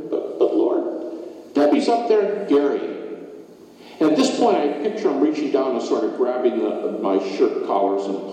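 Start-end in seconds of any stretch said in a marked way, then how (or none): 0.1-2.9 higher, faltering protest to God; pleading objection. Acted. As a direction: stammer out a pained objection, pitch lifting.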